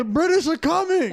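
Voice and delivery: high, hammed-up town-crier yell; mock warning yell. Paul Revere warning the town, mock yelling. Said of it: "I wanna know how he yelled".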